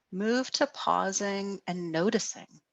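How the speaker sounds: ordinary talk, nothing marked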